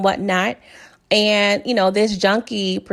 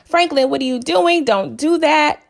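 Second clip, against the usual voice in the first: mild mock yell; 6 semitones higher than usual. Sissy pleading with Franklin to stop, mild mock yell. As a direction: imitate a yell without really raising the volume. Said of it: "Sissy starts yelling".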